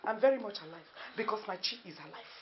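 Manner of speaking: ordinary talk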